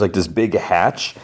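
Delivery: usual speaking voice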